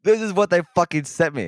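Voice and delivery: loud, high, indignant protest; outraged complaint. Real, not acted. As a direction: shove the jacket at them, fuming. Showing the wrong jacket.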